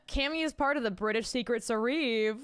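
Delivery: "Service" mispronounced as "suh-REEV"; misspelling read aloud. Retold or imitated. Teasing a chatter's typo, "Service" said as "suh-REEV". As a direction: say "Service" as a long "suh-reeeve". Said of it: "I know you meant to say service".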